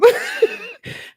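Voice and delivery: squealing burst of laughter; real squeal of laughter; high cracking laugh. Real, not acted. Paranoid joke cracks her up.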